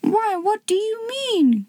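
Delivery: high-pitched, affronted question; mock outrage in a soft high voice; indignant hen. A narrator's reading. Old red hen challenging the owl, indignant. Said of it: "as mad as hops was she".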